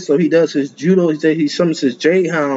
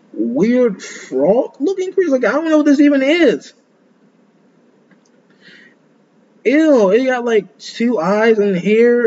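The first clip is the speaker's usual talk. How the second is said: grossed out; 8 semitones higher, slower than usual. Real, not acted. Grossed out by a creature.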